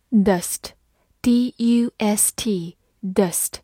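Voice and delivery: measured, well-spaced dictation of a word; slow spelling aloud. Shown as a demonstration. Teacher introduces a new word to learners, spelled out slowly.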